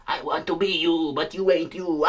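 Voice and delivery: a lightly hammed dying villain. Timothy's dying words.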